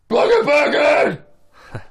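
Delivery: hollered Sloth-from-Goonies impression, high and drawn out; Brett the janitor. Janitor yelling down the hall after being unplugged, Sloth-like holler. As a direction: bellow it slowly, way up high, like Sloth. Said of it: "kinda sounded like Sloth from Goonies".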